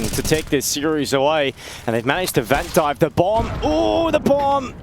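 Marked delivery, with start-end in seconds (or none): 3.0-4.7 surprised. Real, not acted. Bomb barely drops down the vent.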